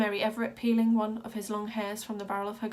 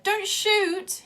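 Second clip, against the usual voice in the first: much higher, louder panicked yell; 11 semitones higher, 8 dB louder than usual. A narrator's reading. Wild Boy yelling at the ringmaster holding a shotgun. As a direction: scream it at the gun. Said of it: "he yelled".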